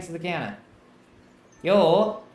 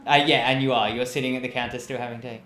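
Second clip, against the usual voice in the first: ordinary talk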